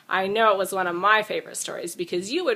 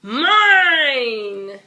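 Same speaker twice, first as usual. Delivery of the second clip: loud drawn-out wail, crying; 4 semitones higher, 7 dB louder than usual. Acted. Dinosaur wailing during tug of war, loud. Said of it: "as he wailed".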